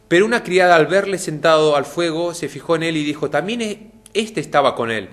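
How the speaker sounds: loud, high, fervent Bible reading; preacher's dramatic scripture voice. Acted. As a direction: proclaim the scene with rising fervour.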